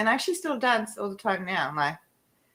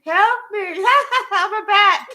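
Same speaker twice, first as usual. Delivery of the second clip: high, loud, laughing through a mock plea; laughing cry for help; 13 semitones higher, 6 dB louder, slower than usual. Stuck girl calling to family, high laughing plea. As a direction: plead through helpless laughter.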